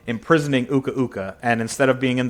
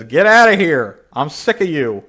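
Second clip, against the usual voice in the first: loud, higher-pitched fed-up outburst; angry dismissal; 5 semitones higher, 6 dB louder than usual. Acted. Coco throws Cortex out, angry and fed up. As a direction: burst out loud, then ease off.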